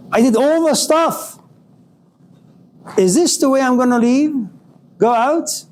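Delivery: high, bitter whining protest; whiny complaint. Acted. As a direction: whine it like life cheated you. Bitter complaint in anger stage.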